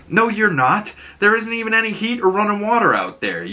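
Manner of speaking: high mock stern dad; firm refusal. Joel stopping Ellie moving out, high firm refusal. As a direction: shut her down up high. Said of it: "And Joel's like".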